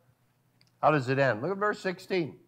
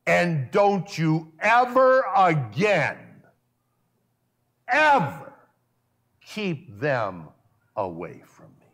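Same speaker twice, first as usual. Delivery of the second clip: stern command; 3 dB louder, slower than usual. Shown as a demonstration. Preacher reworking the verse as Jesus's stern, slow, louder command. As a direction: lay down the law like an irate Jesus. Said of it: "Does that sound a little different?".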